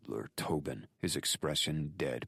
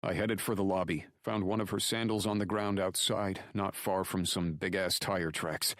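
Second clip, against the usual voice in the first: higher-pitched, urgent report; Drake reporting urgently; 7 semitones higher, 4 dB louder than usual. Acted. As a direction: relay what you found over the phone. Voices Drake reporting by phone.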